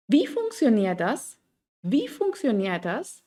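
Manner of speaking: slow, Wie stressed and a final rise. Shown as a demonstration. Teacher modelling W-question stress, slow. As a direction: lean on Wie. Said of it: "Die Satzbetonung ist immer auf das W-Wort".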